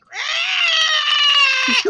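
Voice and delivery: long, very high loud shriek; voracious monster screech. Retold or imitated. Teacher imitating a pupil crawling on all fours, monster screech. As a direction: imitate a kid's ravenous creature scream. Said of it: "making the most voracious sound".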